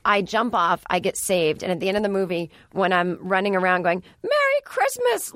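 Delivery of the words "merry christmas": high, drawn-out mock holler; George Bailey. Running around at the movie's end, mock hollering. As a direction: call it out stretched and very high. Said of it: "Like, this is George Bailey".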